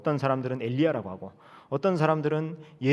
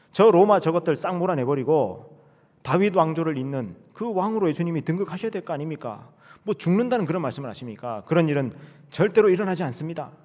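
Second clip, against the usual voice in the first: loud, higher indignant protest; Peter protesting; 4 semitones higher, 4 dB louder than usual. Acted. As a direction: push back hard at the teacher. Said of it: "항변하면서 대듭니다".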